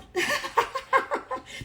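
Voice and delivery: high, soft giggling laugh; amused laugh. Real, not acted. Preacher amused at the obvious point, high soft laughter. Laughs after repeating "sealed.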